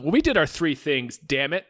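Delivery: ordinary talk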